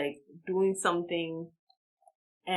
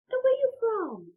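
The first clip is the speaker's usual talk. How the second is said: high-pitched put-on question; imitated curious man; 4 dB louder than usual. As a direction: ask it up high as the nosy passenger. Man starts chatting her up, voiced.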